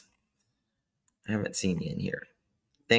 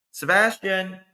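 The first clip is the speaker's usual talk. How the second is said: higher-pitched mock call for a child; 8 semitones higher than usual. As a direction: act a parent calling through the house. Acts parent calling for child.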